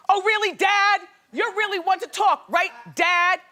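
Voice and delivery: high, loud taunting jab at dad; sassy comeback to dad. Pretend. Advising Harry how to taunt his dad, mock sass. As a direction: needle your dad with a sarcastic dare. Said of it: "You, you go".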